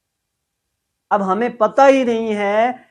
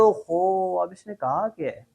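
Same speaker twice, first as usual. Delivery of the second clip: softer, puzzled oho under the breath; mock confusion, quiet; confused mutter; 4 semitones lower, 8 dB quieter than usual. Student lost at English reply, quiet confused. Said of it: "अगर हमें ये नहीं पता चलेगा".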